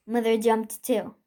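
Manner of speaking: usual speaking voice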